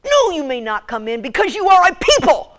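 high, loud sulky no; bratty daughter. Retold or imitated. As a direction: snap it back like a fed-up teen. Daughter's final refusal.

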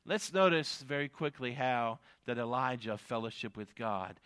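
ordinary talk, nothing marked

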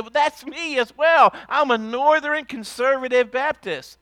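high, loud, eager cry of agreement; excited shout. Acted. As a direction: blurt it out delighted to have found a match. Eager man claims same church.

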